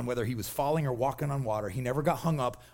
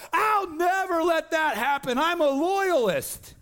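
high, slow, overeager loyal disciple; overeager Peter; 12 semitones higher, 4 dB louder, slower than usual. Retold or imitated. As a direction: swear loyalty like a puffed-up sidekick. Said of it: "To which Peter's like".

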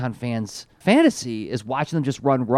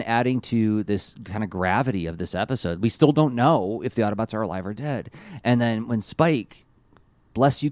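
ordinary talk, nothing marked

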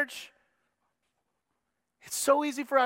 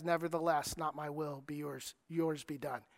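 much softer, hushed and yielding; trusting surrender; 8 semitones lower, 11 dB quieter than usual. Retold or imitated. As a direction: give it up softly in full trust. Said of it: "the tone in which Jesus says".

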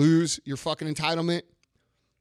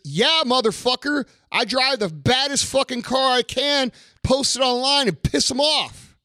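loud, slow, higher-pitched yelling; angry shouting; 9 semitones higher, 5 dB louder than usual. Real, not acted. Ranting at weak fans who ask about haters, shouting. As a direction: bellow it out, fed up.

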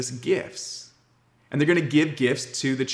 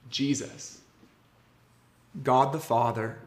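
normal talking voice

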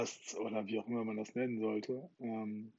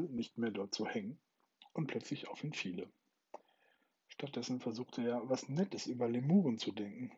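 measured audiobook reading, softer than chat; story narration; 6 dB quieter than usual. A narrator's reading. Podcast host reads a Douglas Adams passage to listeners, story narration. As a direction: read it as a calm, paced novel narration.

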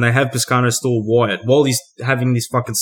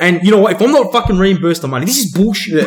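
mock indignant complaining, pitched up; higher, worked-up griping in imitation; old-school Italian grumbler; 5 semitones higher, 4 dB louder than usual. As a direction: play an aggrieved old Italian man. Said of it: "He's a typical old school Italian".

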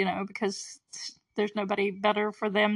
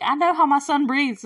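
insistent mom; 6 semitones higher, 6 dB louder than usual. Retold or imitated. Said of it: "She's like".

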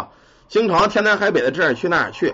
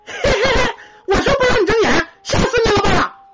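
put-on very high squeaky kid voice, opening on a giggle; gleeful ghost child. Ghost child to the man who opened his eyes, giggly high-pitched taunt. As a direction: play a ghost kid delighted by the scare. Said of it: "这小孩一看他睁眼了，就乐了".

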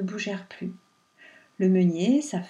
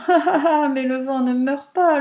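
mocking laughter, higher and louder; laughing reply; 4 semitones higher, 6 dB louder than usual. A narrator's reading. Pierrot laughs at the pleading wind, mocking. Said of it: "répondit en riant".

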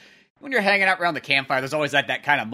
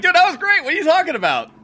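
much higher, louder gleeful yell; excited shout; 7 dB louder than usual. Retold or imitated. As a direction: blurt it out overjoyed. Kyle loving the party.

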